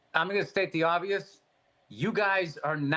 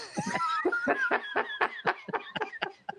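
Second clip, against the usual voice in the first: shrieking laugh, very high; high burst of laughter; 3 dB quieter than usual. Real, not acted. Cracking up at his own bar story, high shrieking laugh. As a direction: let out a high squealing laugh.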